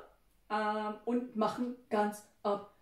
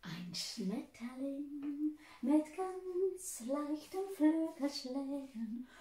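soft and slow; 2 semitones higher, 10 dB quieter, much slower than usual. Acted. Teacher describing butterfly softly.

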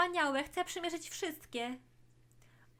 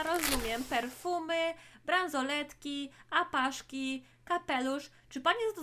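usual speaking voice

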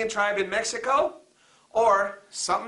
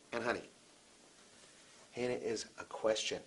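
much softer, lower "honey"; soft correction; 9 semitones lower, 15 dB quieter, faster than usual. Real, not acted. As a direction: lean in and correct gently. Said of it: "teacher correcting student".

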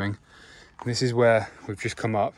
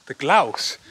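surprised at own slip; 4 semitones higher, 9 dB louder than usual. Real, not acted. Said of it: "What am I saying today?".

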